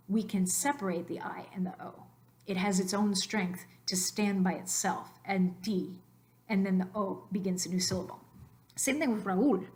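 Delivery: usual speaking voice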